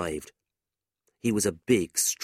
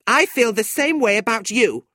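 high, loud comeback; angry retort; 7 dB louder than usual. A narrator's reading. Tilda returning Fanny's hatred, angry. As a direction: hurl it straight back, pitched high.

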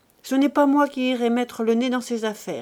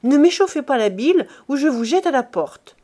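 angry threat; 3 semitones higher, 4 dB louder than usual. Acted. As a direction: throw him out with your voice. Pierre threatens his brother.

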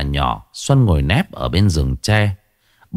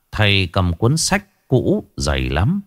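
ordinary delivery

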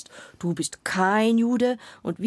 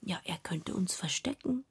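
whisper; 8 dB quieter than usual. Acted. Shoshana speaks softly in game.

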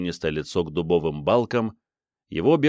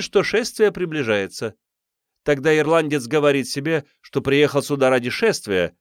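fake Irish accent; 9 semitones higher than usual. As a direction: ham up a silly Irish accent. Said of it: "в фальшивом ирландском акценте женщины". (фальшивый ирландский акцент, высоко)

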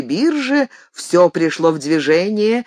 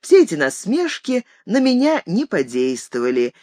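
usual speaking voice

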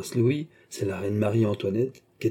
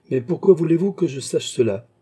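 uneasy, higher-pitched protest; somewhat worried; 6 semitones higher, 4 dB louder than usual. A narrator's reading. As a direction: voice lifted in mild worry. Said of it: "Dit le cardinal assez inquiet".

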